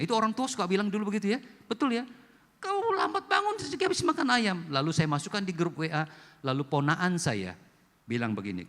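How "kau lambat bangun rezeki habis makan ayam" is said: lightly put-on high old-timer voice, quoting a saying; a hint of an old parent lecturing; old folks' saying. Preacher quotes the old folks' saying to the congregation, in a lightly put-on high voice.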